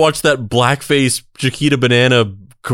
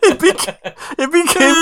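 laughing. Real, not acted. Cringing at the video.

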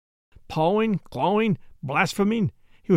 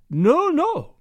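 put-on high, louder German-accented cry of no; 8 semitones higher, 5 dB louder than usual. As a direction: Jan cries out in alarm, accent held. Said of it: "he cried".

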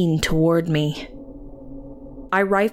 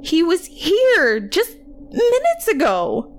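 high, slow, distressed plea; panicked insistence; 12 semitones higher, slower than usual. A narrator's reading. Worker to boy's aunt, panicked. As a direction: stretch it out in high-pitched dismay.

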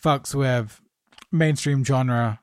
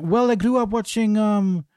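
higher, hammed-up French accent, imitating a French actor; jokey imitation of a French accent, higher pitched; bad French accent; 5 semitones higher than usual. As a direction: put on a playful mock French accent. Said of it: "excuse the bad French accent".

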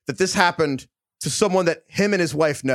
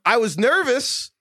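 distressed; 11 semitones higher than usual. Retold or imitated. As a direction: plead it like a mortified guy. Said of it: "And Will's like".

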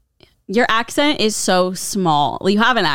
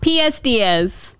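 slow, put-on Boston accent; 3 semitones higher, slower than usual. Shown as a demonstration. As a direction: draw out the letters in Boston style. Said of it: "we're doing a Boston accent".

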